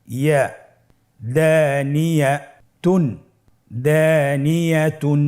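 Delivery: slow syllable drill, then each word joined; measured, chant-like teaching recitation; broken into syllables. Shown as a demonstration. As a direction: say it piece by piece, holding long vowels for pupils to repeat. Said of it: "ভেঙে ভেঙে পড়ব".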